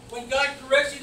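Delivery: ordinary delivery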